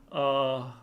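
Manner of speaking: ordinary talk, nothing marked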